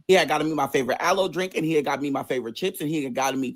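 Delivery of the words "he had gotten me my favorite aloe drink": ordinary delivery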